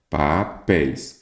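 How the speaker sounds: "Papéis" slowly split as pa-péis; syllable by syllable. Shown as a demonstration. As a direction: draw out "Papéis", pausing between its two syllables. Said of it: "Qual que é a sílaba tônica?".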